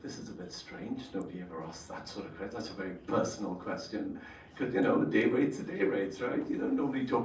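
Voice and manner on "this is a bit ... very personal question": muttered to self, softer; thinking it under the breath; hushed inner thought. Retold or imitated. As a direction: private thought kept low.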